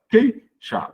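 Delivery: "Queixar" as QUEI, pause, XAR, pitched high; split into syllables. Shown as a demonstration. Teacher repeating a non-hiatus for pupils, syllables split. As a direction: break "Queixar" only after QUEI, EI held together. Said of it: "eu não separo".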